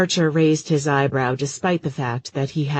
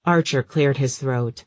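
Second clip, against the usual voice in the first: ordinary delivery, nothing marked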